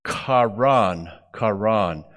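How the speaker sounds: karan said kah-RAHN, with two ah vowels; Hebrew verb pronunciation. Shown as a demonstration. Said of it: "the pronunciation is different".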